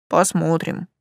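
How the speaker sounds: noncommittal, understated reply; evasive. A narrator's reading. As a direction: keep it guarded. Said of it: "уклончиво сказал каменщик Ранг". (уклончиво, сдержанно)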